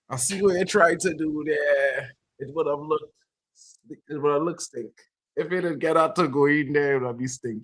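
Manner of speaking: normal talking voice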